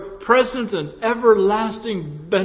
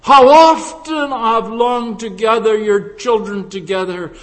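loud, impassioned scripture lament; crying out; 4 semitones higher, 9 dB louder than usual. Acted. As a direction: cry it out to a whole city. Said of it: "and he cried".

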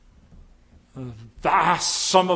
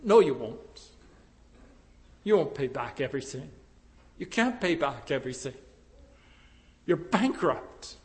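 quiet rebuttal; 3 semitones lower, 5 dB quieter than usual. Acted. Preacher answering the debtor's empty promise, soft and low.

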